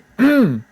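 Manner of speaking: sharp, high throat clear. Real, not acted. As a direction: clear the throat after a crack. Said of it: "Oh, my voice cracked there".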